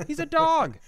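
ordinary delivery

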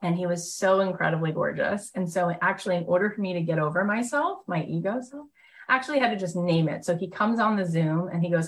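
usual speaking voice

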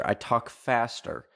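ordinary talk, nothing marked

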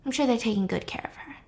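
whispered, fast and teasing; taunting whisper. Acted. Avenger taunting the dean about her daughter in a whisper. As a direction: breathe it like a cruel secret.

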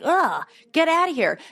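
high-pitched, exasperated brush-off; disgusted dismissal. Acted. Host answers the slimy inner troll, disgusted dismissal. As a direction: shoo away a pest with an acted groan. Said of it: "And you're like".

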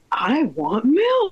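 high, slow crying wail; slow high mock-crying kid; whiny toddler cry. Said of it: "he's like".

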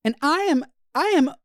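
high imitated heartfelt vent; imitating a venting girl. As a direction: parody a girl airing her feelings. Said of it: "they're like".

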